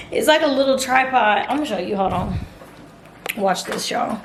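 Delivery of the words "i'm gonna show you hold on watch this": normal talking voice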